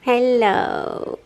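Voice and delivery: sing-song puppy voice, high and slow; high cooing pet voice. Real, not acted. Greeting the puppy.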